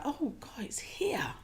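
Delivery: high, breathless whisper of wonder; awed whisper. Retold or imitated. Meditator suddenly finding happiness, high awed whisper. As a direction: let it slip out as a hushed revelation. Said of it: "it was like".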